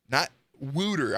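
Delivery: "wooter" with an oo vowel; "wooter" held up as the wrong way; rejected pronunciation. Shown as a demonstration. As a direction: stress the oo in "wooter" dismissively. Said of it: "one word that I found that I don't like personally is wooter".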